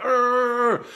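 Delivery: high, loud strangled cry; mock panicked yell. Diver signalling his buddy he has no air, high yell. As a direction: yell it like you have no air left. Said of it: "And I went".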